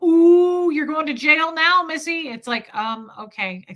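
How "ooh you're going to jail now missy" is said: high, loud sing-song taunting; hammed-up threat, much higher; mock taunt. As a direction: tease like a smug bully. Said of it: "people kind of threaten me, like".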